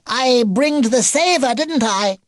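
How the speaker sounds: put-on slow, squeaky high toddler voice; baby beaver, boastful. Baby beaver boasting to his grandpa, squeaky and proud. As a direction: draw it out proudly, high and loud.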